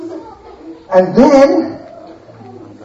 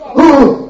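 gasp; 3 semitones higher, 3 dB louder than usual. Acted. Finding broken idols.

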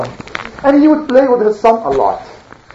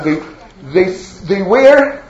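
ordinary delivery, nothing marked

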